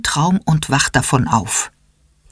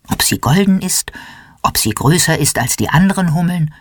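usual speaking voice

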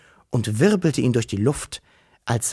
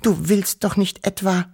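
a light, higher tremble of fear; quavering voice; 7 semitones higher than usual. Acted. Wischel fearfully asks her brother about the tracks, a touch of quaver. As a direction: ask it with a mildly trembling voice, scared of the answer. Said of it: "mit bibbernder Stimme".

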